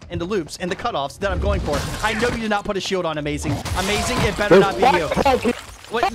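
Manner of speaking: ordinary talk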